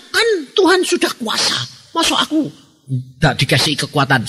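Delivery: high, put-on voice of a complacent believer; lightly mock the excuse in a high voice; mocking whiny believer. Preacher mimics a lazy believer to the congregation, high mocking voice.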